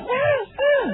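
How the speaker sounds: put-on high squeal of a delighted kid; gleeful child cry. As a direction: squeal like a happy little boy. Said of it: "mulai teriak".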